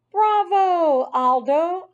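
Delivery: high, loud squeaky cheer; loud squeak. A narrator's reading. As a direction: squeak it out as loud as you can. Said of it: "I squeaked as loudly as I could".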